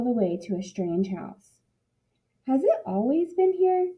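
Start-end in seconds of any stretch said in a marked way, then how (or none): 2.5-3.9 put-on higher, curious little girl voice; wondering aloud. A narrator's reading. Kiko wondering at a strange house, a higher curious voice. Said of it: "Kiko wondered".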